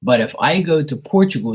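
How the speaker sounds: ordinary talk, nothing marked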